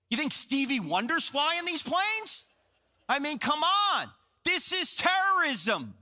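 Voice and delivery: high, exasperated outburst, slowing on key words; indignant retort. Retold or imitated. Viewer arguing back at the CNN commentator, high indignant outburst. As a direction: act out scornful disbelief, pitch climbing.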